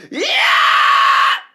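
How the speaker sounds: high, loud raspy fry scream; scream with vocal fry. Shown as a demonstration. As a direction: layer grit over a high belt. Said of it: "A little bit of vocal fry on top of it".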